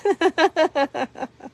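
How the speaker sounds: light high laugh; short real chuckle. Real, not acted. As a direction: laugh at your own line. Laughs after her crying remark.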